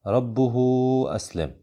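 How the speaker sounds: "ربه" stretched to rabbuhooo, then "أسلم" drops; long connecting madd. Shown as a demonstration. Teacher models the major connection rule, "ربه" with long held oo. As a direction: hold the final oo of "ربه" before "أسلم". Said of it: "ومقدار مده حركتان أو أربع حركات".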